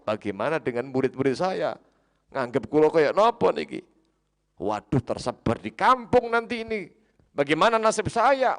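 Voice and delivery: loud, high fretting that keeps climbing; acted panic, building to a high cry; panicked lament. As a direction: wail in alarm over your ruined name. Barsiso panics about his reputation.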